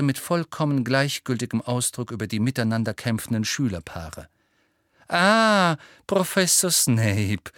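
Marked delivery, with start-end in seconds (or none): 5.1-7.5 high, slow, sweet greeting; sugary Umbridge. A narrator's reading. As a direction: gush falsely sweet at the visitor. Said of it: "sagte Umbridge mit breitem Lächeln". (süßlich breites Lächeln in der Stimme)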